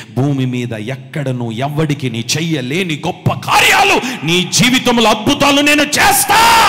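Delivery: God's promise, building to a shout. Acted. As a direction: swell from hushed to shouting.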